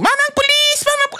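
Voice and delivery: very high, desperate call for help; calling the police. Pretend. Robbed citizen shouting to a police officer for help. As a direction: scream for the officer. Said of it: "Sasabihin mo".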